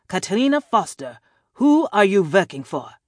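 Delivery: put-on loud, high interrogator with a European accent; European-accented villain demanding an answer; hard-to-place European accent. A narrator's reading. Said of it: "a hard-to-place European accent".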